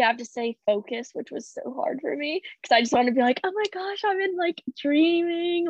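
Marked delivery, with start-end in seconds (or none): 3.4-5.7 much higher, starstruck squeal; dreamy excited squeal; giddy gushing. Retold or imitated. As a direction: gush like a starstruck fan. Wanting to gush at Olympics.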